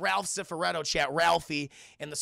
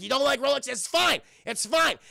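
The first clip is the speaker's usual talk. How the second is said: louder, higher, touchy insistence; defensive huff; 6 semitones higher, 5 dB louder than usual. Retold or imitated. Rebuffed street seller insists to Olive, defensive huff. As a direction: brush off the rejection too forcefully.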